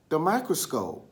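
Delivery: plain reading voice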